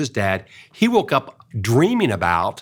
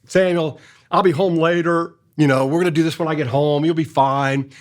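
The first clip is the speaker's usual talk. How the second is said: mock breezy brush-off; 5 semitones higher than usual. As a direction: wave the kid's sadness away cheerfully.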